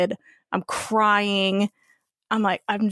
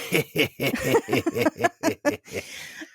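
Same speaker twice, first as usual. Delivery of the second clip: laugh; 6 semitones lower, 3 dB quieter than usual. Real, not acted. Laughing at own story.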